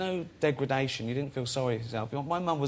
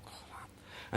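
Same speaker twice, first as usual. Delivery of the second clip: imitating a hushed whisper; 17 dB quieter than usual. Old couple whispering during the take, mock whisper. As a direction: breathe it as a voiceless whisper. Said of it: "I could hear 'em sort of whispering".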